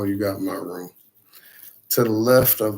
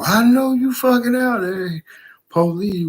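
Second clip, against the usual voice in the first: raised, high-pitched paranoid shout; shouted warning; 12 semitones higher, 5 dB louder than usual. Retold or imitated. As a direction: call out the hidden police in a high, loud voice. Acted shout at imagined police outside.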